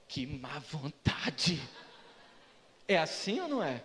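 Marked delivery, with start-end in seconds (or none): 0.0-1.6 low, drawn-out mock grumble; grumbling complaint. Churchgoer complaining about the pastor's short prayer, grumbling. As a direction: stretch it out, disgruntled.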